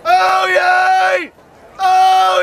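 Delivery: ordinary talk, nothing marked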